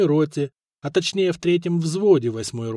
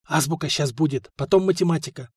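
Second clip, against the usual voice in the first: ordinary delivery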